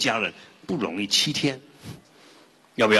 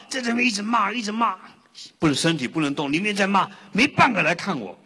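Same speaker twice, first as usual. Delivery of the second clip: higher, indignant grumbling; cursing from the coffin; 9 semitones higher than usual. Retold or imitated. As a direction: rant as if nobody came to see you.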